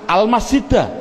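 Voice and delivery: "Al-masjidah" ending in an open a; nasab ending. Shown as a demonstration. Teacher answers his own drill question, nasab ending -da. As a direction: sound out "Al-masjidah" with final -da, no n. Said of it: "Nasabnya bunyinya bagaimana".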